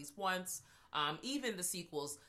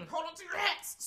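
mock announcer; 12 semitones higher, 4 dB louder than usual. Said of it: "announcing the spoiler".

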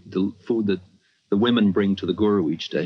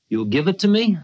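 much higher, sly asking voice; a teasing old guru angling for a gift; guru's playful request; 10 semitones higher, 3 dB louder than usual. Retold or imitated. Old guru teasing the visitor for his car, high and sly. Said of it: "guru asks for the car".

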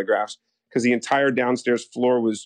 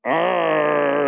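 a louder, higher mock animal growl; hammed-up growl; 5 semitones higher, 3 dB louder than usual. Actor in a cage on a leash, growling for the photographers. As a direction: give a silly caged-beast growl. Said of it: "And I'm like".

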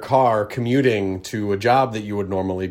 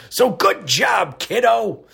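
higher, slower put-on old-timer voice "So good job, kiddo"; older folks' praise; 8 semitones higher, slower than usual. Host teasing a younger listener, hammed-up old-timer voice. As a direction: ham up the proud grandpa. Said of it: "as us older folks say".

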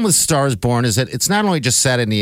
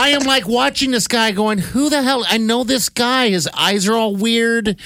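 baffled; 11 semitones higher than usual. Retold or imitated. Baffled by unrecognised actor.

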